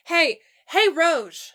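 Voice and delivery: loud call across the party to a friend. A narrator's reading. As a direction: wave someone over, loud. Dave calls to his friend.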